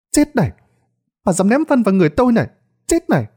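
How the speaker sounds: high, quick vengeful cries; angry boy. A narrator's reading. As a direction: yell it as you pelt the attacker. Boy throws stones back in anger. (giọng thằng bé tức tối, the thé)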